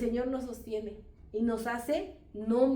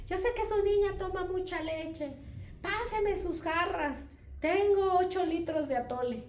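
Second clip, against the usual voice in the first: neighbor calling out; 8 semitones higher than usual. Retold or imitated. Tamale seller offering atole to her neighbor, high calling voice. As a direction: call the offer over, high and stretched out. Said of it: "mi vecina que vende tamales luego me gritaba".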